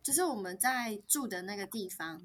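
ordinary talk, nothing marked

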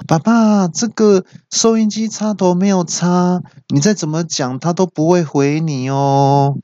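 put-on high, slow child-like voice; little daughter's voice. Daughter tells dad radio is unplugged, child voice. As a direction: imitate a young girl informing dad. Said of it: "妹妹突然就说".

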